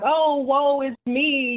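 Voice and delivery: high, drawn-out mock lament; mock self-pity. Mother mimicking the wallowing she refuses, mock self-pitying. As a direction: stretch it out, wallowing in imitation woe. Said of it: "wallow in it and be like".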